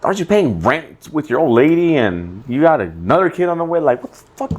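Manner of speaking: imitating his own angry rant at a friend; retold rant. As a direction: replay the scolding with pitch up. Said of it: "It's like".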